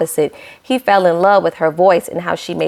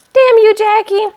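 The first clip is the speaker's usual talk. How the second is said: high, loud mock curse; mock outrage; 13 semitones higher, 4 dB louder than usual. Vlogger cursing Jackie for hurting Marshall, mock outrage. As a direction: shake your fist at the villain, half joking.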